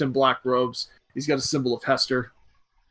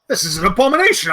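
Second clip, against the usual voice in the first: high, loud priestly outrage; appalled, raised-voice objection; indignant outburst; 7 semitones higher, 5 dB louder than usual. Acted. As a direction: protest as if scandalized. Priest objects to healing the giant.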